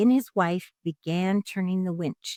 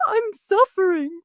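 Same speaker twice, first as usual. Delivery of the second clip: high, loud pleading wail; 12 semitones higher, 6 dB louder than usual. A narrator's reading. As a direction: wail it like a desperate beggar. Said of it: "the fox wailed".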